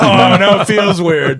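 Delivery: squirming, put-on voice; awkward. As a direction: drag out the embarrassment. Retelling his own awkward reaction.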